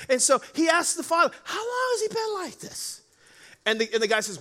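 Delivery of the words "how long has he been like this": soft, easy, high question; cool and calm. Retold or imitated. Jesus calmly asks the frantic father, softly. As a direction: ask it gently, unbothered. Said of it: "cool as a cucumber".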